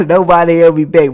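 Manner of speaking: loud punchline through real laughter; laughing. Real, not acted. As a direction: crack up while repeating the punchline. Repeats punchline while laughing.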